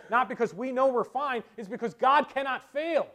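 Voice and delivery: high, driving pulpit voice; fervent preaching. Real, not acted. Preacher assuring congregation God cannot fail, preaching fervently. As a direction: press hope home with conviction.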